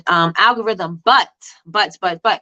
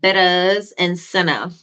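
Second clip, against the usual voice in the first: fake Jamaican accent. Teacher joking to her live chat in a fake Jamaican accent. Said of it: "And my fake Jamaican voice".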